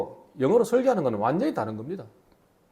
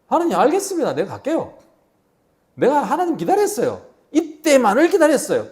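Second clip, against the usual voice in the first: higher and louder, bursting with eagerness; eager volunteer; 8 semitones higher, 5 dB louder than usual. Pretend. 기다렸다는 듯 신난 모세가 하나님께 외치듯, 과장되게 들뜬 목소리. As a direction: jump at the call, hammed-up and keen. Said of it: "만약에 이렇게 말했다고 생각합시다".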